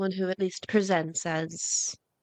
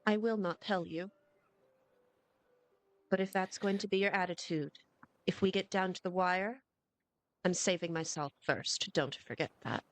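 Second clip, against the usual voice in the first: quiet; 8 dB quieter than usual. Acted. Low threat between party members.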